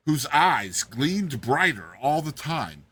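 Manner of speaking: plain reading voice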